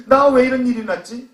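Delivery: high, loud protest; aggrieved complaint. Acted. Blind man protesting his lot, loudly aggrieved. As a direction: burst out complaining, pitch up. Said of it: "마음이 불편해졌을 거예요".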